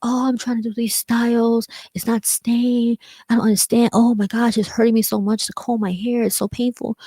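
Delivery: acted frustration. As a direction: whine about hair that won't cooperate. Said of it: "The same thing with your hair".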